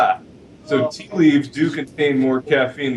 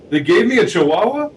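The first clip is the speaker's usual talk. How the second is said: mock surprise; 7 semitones higher, 4 dB louder than usual. Blind lady's shocked punchline.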